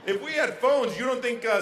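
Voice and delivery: normal talking voice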